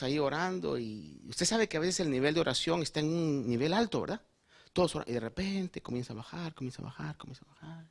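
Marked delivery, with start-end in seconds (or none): 4.7-6.5 from full voice down to a hush; fading away. Shown as a demonstration. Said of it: "Y ya solo se oye un susurro". 6.5-7.2 "comienza a bajar" said much softer, sinking. Shown as a demonstration. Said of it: "Y ya solo se oye un susurro". 7.2-7.9 "comienza a bajar" almost whispered, nearly gone; barely audible. Shown as a demonstration. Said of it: "Y ya solo se oye un susurro".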